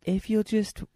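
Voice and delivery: lightly voiced prosecutor soothing a jury. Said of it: "talks like he's soothing a child".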